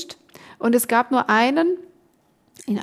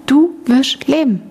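"Du wirst leben" slow and louder, word by word; emphatic assurance; 3 semitones higher, 6 dB louder, slower than usual. Retold or imitated. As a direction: give each word of "Du wirst leben" slow, firm weight. Retold assurance from God.

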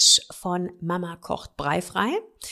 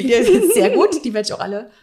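laughing; 4 semitones higher, 6 dB louder than usual. Real, not acted. Joking about eating them all.

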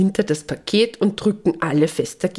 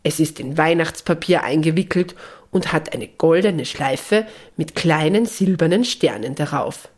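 usual speaking voice

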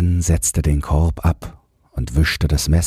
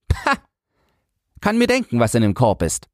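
put-on very high, scoffing child's voice; dismissive boy; 7 semitones higher, faster than usual. A narrator's reading. Boy waves off the secret in the basket, dismissive. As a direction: scoff like a know-it-all boy. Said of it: "meinte Jürgen geringschätzig".

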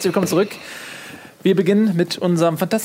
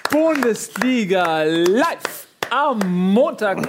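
slow announcer read; 3 semitones higher, much slower than usual. Acted. Show intro announcement.

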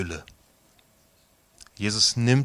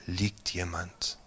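guided meditation; 3 dB quieter than usual. Acted. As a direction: guide them gently into the storm scene. Leitet eine Meditation. (ruhige Meditationsstimme, langsam mit langen Pausen)

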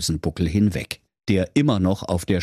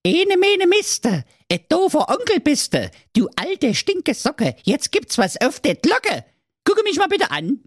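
angry little goat. Acted. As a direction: chant it before the punch. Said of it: "und sprach".

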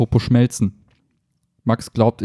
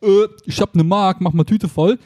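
put-on higher, slow little kid voice; kid at the kiosk; 9 semitones higher, 4 dB louder than usual. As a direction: play a kid buying sweets. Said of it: "wenn man beim Kiosk gesagt hat".

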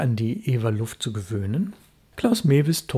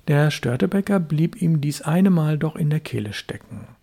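ordinary delivery

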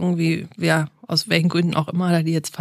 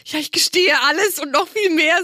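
high-pitched imitated daughter caving in; amused, exaggerated teen confession; mock confession; 9 semitones higher than usual. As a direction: confess everything in a put-on high voice.

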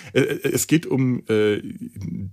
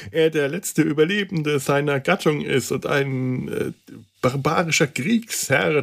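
ordinary delivery

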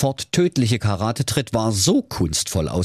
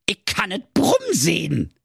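ninja; 11 semitones higher than usual. Acted. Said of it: "sagte der Ninja".

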